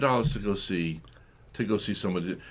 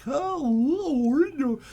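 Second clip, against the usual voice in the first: mock swooning women; 13 semitones higher than usual. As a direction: coo like a woman seduced by an accent. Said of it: "they're like".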